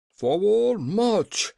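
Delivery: high, loud marching order; generals' cry. A narrator's reading. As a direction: cry out the command to the troops. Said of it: "cried all the generals with one voice".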